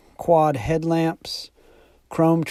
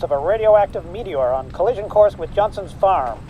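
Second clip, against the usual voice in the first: radio news bulletin; 6 semitones higher than usual. Acted.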